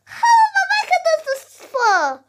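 high, fast, loud squealing joy; overjoyed character voice. Pretend. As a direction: squeal with disbelieving delight. Yoshi reacts to winning.

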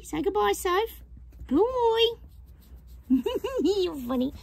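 high, sing-song pet talk; talking to the dog. Real, not acted. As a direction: coo at the dog.

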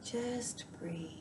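guided meditation. Acted. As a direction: softly guide the listener into calm, unhurried.

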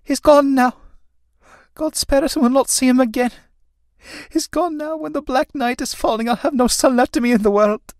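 put-on high, keening lament of an old mother; crying out. Mother cries after son in doorway, high lament. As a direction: cry out high in grief after your departing son. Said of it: "crying out as he is in the doorway".

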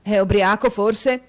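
indignant outburst. A narrator's reading. Squeers indignant at Newman.

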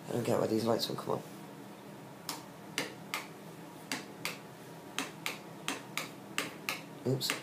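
quiet, slow mutter; barely voiced grumble under breath; muttering to self. Real, not acted. Muttering to self, fiddling lights.

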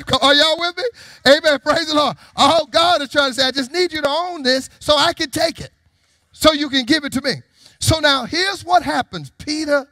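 high, loud preacher's delivery; full-force preaching. Real, not acted. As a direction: preach it out to the congregation.

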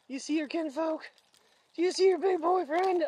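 high, teasing voice for the dog; sing-song talk to a pet. Real, not acted. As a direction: tease the dog sweetly. Talking to the dogs.

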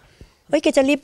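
high, drawn-out startled thought; puzzled self-questioning. Retold or imitated. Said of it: "เก๋ก็สงสัยตัวเองนะคะ". (สงสัยตัวเอง เสียงสูง)

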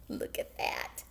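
ordinary delivery

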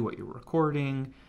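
usual speaking voice

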